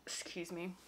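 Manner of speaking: whispered aside; quick quiet apology. Real, not acted. Quiet aside during talk.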